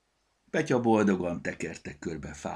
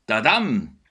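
loud, showy tadam; a loud showy fanfare call; triumphant call; 4 semitones higher, 9 dB louder than usual. A narrator's reading. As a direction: announce the find with flair. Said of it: "kiáltotta".

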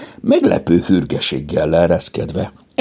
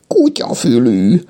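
slow, high cat-like meow; high feline whine; 6 semitones higher, 3 dB louder than usual. A narrator's reading. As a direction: draw it out like a mewing cat. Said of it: "nyávogta".

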